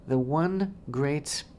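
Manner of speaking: ordinary delivery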